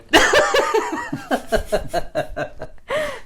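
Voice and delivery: awkward fake laugh. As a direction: laugh them away awkwardly. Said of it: "but I just go".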